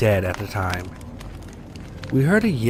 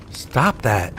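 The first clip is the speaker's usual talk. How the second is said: ordinary delivery, nothing marked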